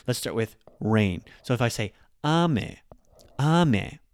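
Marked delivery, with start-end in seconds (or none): 3.4-4.0 ame, A high then ME dropping low. Shown as a demonstration. Teacher demonstrating Japanese pitch for rain: ame high then low. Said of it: "so a is higher and me is lower".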